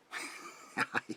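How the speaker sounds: high, breathy cackle; short high laugh at his own ghost joke; creepy laugh. Acted. Host laughs with guest about ghost.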